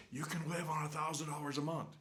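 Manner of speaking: high, put-on voice aping budget-video hosts; mocking budget videos. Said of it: "to say".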